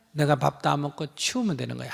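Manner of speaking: ordinary talk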